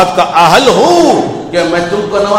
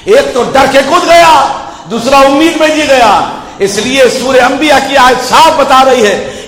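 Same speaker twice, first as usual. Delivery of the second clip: usual speaking voice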